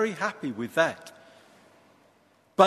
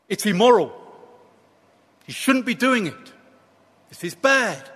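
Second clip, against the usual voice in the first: emphatic, higher-pitched moral outrage; short, forceful bursts of objection; indignant disapproval; 4 semitones higher, 3 dB louder than usual. Acted. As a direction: protest it as a scandalized prophet. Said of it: "You see, to Jonah".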